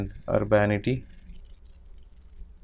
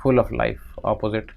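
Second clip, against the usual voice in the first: ordinary talk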